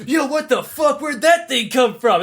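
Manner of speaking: put-on a king shouting what the hell at a surprise baby; hammed-up shocked outburst.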